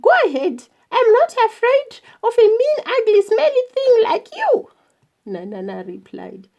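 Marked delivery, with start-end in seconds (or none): 0.0-4.7 high, loud, rapid-fire defiance; defiant retort. A narrator's reading. Fearless mother answering the elephant's threat, defiant. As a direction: hurl the insults back, pitch way up.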